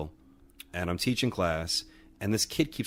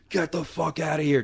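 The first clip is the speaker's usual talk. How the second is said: higher-pitched mock yell, imitating a big angry guy; retold scream; 8 semitones higher than usual. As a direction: bark it like you're chasing someone out. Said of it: "Moff starts screaming in his face".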